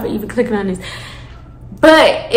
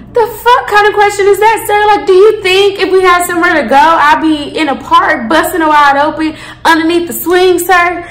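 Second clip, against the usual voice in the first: indignant retort; 6 semitones higher, 3 dB louder than usual. Retold or imitated. Retold comeback to officer.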